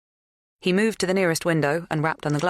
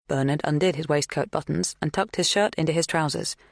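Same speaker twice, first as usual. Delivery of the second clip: usual speaking voice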